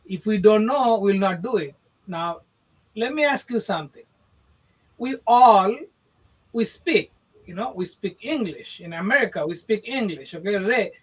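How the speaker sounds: usual speaking voice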